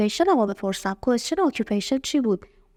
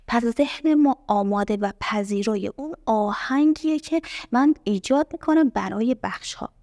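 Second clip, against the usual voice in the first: ordinary talk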